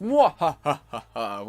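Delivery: slow, drawn-out villain laugh; evil scientist cackle. Acted. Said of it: "I'm supposed to be an evil genius. Evil scientist".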